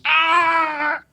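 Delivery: high-pitched yell of pain; imitated scream. Sonny wakes the room at night, screaming. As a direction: mimic a sudden cramp scream. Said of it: "in the night I hear this".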